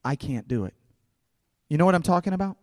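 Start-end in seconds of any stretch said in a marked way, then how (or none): 0.0-0.7 soft, low self-doubt; inner voice. Acted. Fear speaking in your own voice, soft inner voice. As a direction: say it quietly, as a thought to yourself. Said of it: "speaks to you in your own voice".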